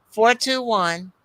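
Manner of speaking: ordinary talk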